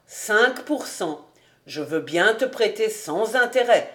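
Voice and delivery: ordinary delivery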